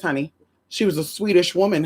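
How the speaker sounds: ordinary delivery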